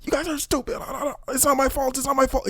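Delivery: fake-sob a tantrum; hammed-up crying meltdown; mocking meltdown. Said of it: "he's crashing out like".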